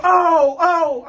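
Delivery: high-pitched cry of alarm; shocked. Retold or imitated. Man catching his bunkie naked, shocked. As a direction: recoil with a high, startled exclamation.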